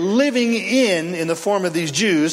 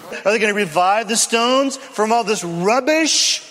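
ordinary talk, nothing marked

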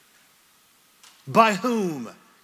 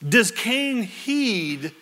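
ordinary talk

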